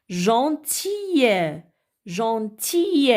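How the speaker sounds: Gentil split as gen - til, second syllable stretched. Shown as a demonstration. Teacher helping children read, Gentil in separated syllables. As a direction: break Gentil in two, drawing out the ending. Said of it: "découper les mots en syllabes".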